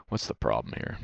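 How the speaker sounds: muttered to himself. Real, not acted. Reviewing his loss, he mutters the question to himself. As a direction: drop it low and mutter it to yourself.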